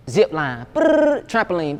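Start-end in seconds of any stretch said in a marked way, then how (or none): 0.7-1.2 high, loud lip-trill beat noise; trilled brrr. Retold or imitated. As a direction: buzz the lips like a drum roll. Beat sound between name ideas.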